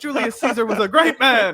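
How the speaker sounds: high-pitched, hammed-up indignation; playfully scandalised defence, pitched high; mock outrage. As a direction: protest in pretend offence.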